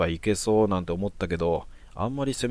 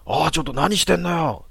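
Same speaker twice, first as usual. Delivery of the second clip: high, loud furious yell from upstairs; angry shout; 8 semitones higher, 6 dB louder than usual. Acted. Neighbour woman yells from her window, high and loud. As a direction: bark it down at the intruders. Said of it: "と怒鳴り声が".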